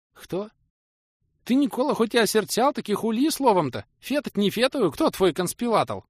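put-on high, lisping old craftsman; high lisping peasant voice, loud and pressing; Nikodim's voice. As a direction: raise the pitch and lisp the r's like a simple peasant.

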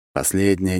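usual speaking voice

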